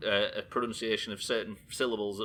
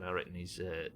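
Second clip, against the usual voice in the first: ordinary talk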